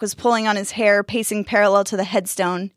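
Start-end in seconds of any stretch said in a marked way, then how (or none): none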